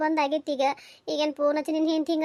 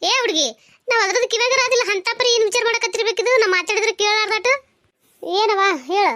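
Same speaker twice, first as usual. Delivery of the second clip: high, raised, exasperated calling; scolding mother; 6 semitones higher, 4 dB louder than usual. Acted. Mother calling her brooding daughter to eat, exasperated scolding. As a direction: call out crossly to someone not listening.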